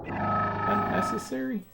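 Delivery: high, thin whisper of a refusal; mock squeaky refusal. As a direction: squeak it out like a cheeky villain. Joking refusal to save friends.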